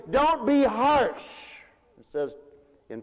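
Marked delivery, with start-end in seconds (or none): 0.1-1.5 slow, loud and high, each word spaced; self-admonition. Shown as a demonstration. Preacher telling himself not to be harsh, slow and loud. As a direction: tell yourself firmly and slowly. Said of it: "and I say".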